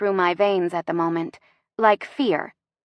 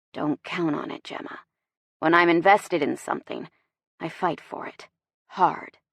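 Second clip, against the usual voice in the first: determined male love interest; 2 semitones lower than usual. Acted. Narrator voices the man.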